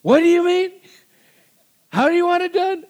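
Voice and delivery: high, loud mock exasperation; exasperated husband. Husband to wife over how to do things, exasperated. Acting out exasperated marital exchange.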